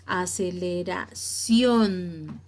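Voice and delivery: aceleración broken up, with "ción" set apart and held; teacher sounding out aceleración to show final "ción"; stress on last syllable. Shown as a demonstration. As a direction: pause before the "ción" of aceleración, then stretch it. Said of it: "la sílaba tónica".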